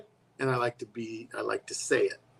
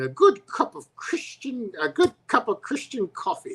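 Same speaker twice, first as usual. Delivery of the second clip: high-pitched, hammed-up Irish lilt; bad Irish accent; 10 semitones higher than usual. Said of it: "I can't do an Irish accent".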